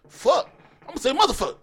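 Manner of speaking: high, loud, fast punchline; hyped comedian voice. Retold or imitated. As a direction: shout it like a stand-up bit. Quoting comedian's line.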